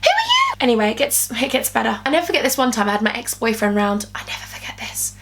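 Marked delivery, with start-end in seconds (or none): 0.0-0.5 very high shocked squeal of who are you; shocked question. Retold or imitated. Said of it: "I'd be like". 4.1-5.1 whispered aside. Real, not acted. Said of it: "whispered aside".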